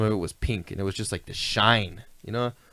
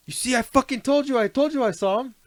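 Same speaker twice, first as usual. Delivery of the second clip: high, triumphant I-told-you; excited told-you; 11 semitones higher than usual. Retold or imitated. Peter proving he saw the UFOs, high excited gloat. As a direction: crow it up high, proven right.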